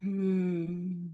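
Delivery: soft low hum on the exhale; a quiet relaxing hum; gentle hum. Shown as a demonstration. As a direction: hum it gently, barely there. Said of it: "now give a gentle hum".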